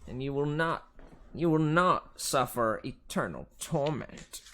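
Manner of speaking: high, slow Southern drawl; struggling Southern accent. A narrator's reading. Fury teacher bargaining with the hero, attempted Southern accent. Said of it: "I'm struggling a bit with the Southern accent".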